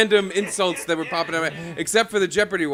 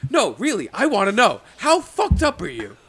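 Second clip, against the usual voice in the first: raised, heated mock grilling; imitating angry boss; 4 semitones higher, 4 dB louder than usual. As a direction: press him, voice climbing.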